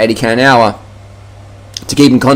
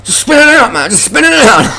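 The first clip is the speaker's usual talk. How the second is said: much higher, silly whiny mock voice; mocking a know-it-all rider; 13 semitones higher than usual. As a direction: ham up a clueless cyclist who thinks he's right. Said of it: "He goes".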